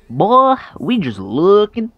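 high, loud mocking dismissal, drawn out at first; rude brush-off. Brushing off the waitress rudely.

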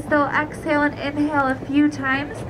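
ordinary talk

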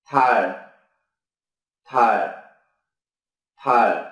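"thải" said low with a falling end; Korean word demo. Shown as a demonstration. Teacher modelling the Korean word for moon, low and falling. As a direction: drop "thải" low and short. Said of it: "Ví dụ từ mặt trăng".

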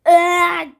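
loud drawn-out "eee" outburst; demonstrated yell. Shown as a demonstration. Sick vlogger showing viewers the yelling they can't do, loud mock yell. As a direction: yell it briefly to show what is off limits. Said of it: "diye bağıramam".

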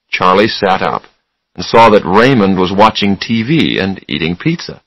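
ordinary delivery, nothing marked

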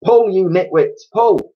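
high, loud exasperated command; shouted order. A narrator's reading. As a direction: bellow at hopeless helpers to heave. Monkey ordering helpers to haul.

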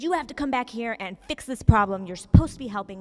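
put-on loud demanding old-woman rant; irate scolding. Margaret scolding the volunteer over the phone, loud and demanding. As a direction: scold him like he failed. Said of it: "she says".

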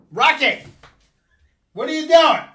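sharp high shout at the pet; loud yell. Real, not acted. Scolding pet Rocket.

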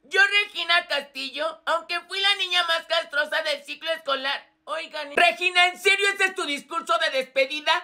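ordinary delivery

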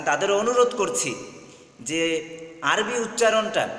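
ordinary talk

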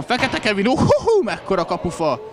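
high, loud cry at the post; excited hungarian sports commentary. Real, not acted. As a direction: yelp in thrilled disbelief. Shot hits the post. (izgatott magyar sportkommentár)

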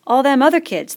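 worried schoolboy asking; fat boy voice. Acted.

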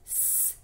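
soft hissed s; snake hiss. Shown as a demonstration. Teacher modelling the s sound as a hiss. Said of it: "pretend to be a snake with me".

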